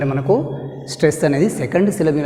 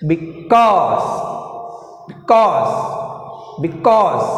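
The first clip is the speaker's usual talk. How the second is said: "because" with a long, forceful CAUSE; be-CAUSE, each "because" drilled with end stress; stress on second syllable; much slower than usual. Shown as a demonstration. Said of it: "ఇప్పుడు నేను stress ప్రకారం pronounce చేస్తా".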